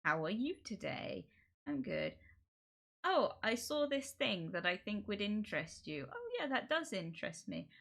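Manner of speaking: politely agreeable scripted chat, fairly soft; people-pleaser small talk. Acted. Acting out a people-pleaser conversation to avoid confrontation, eagerly polite. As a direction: play both sides of pleasant movie small talk. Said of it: "it's the people pleaser conversation".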